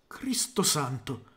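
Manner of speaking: slow, breathless whisper of awe; awed whisper. A narrator's reading. Carl sees the glowing unicorn, whispering in awe. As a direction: whisper it, struck with fearful respect. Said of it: "mormorò Carl con timoroso rispetto".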